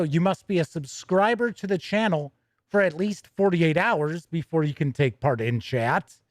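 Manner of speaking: usual speaking voice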